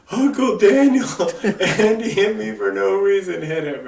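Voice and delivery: high, loud tattletale whine broken by laughter; whiny little sister, laughing through it. Retold or imitated. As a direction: mimic the kid sister while cracking up.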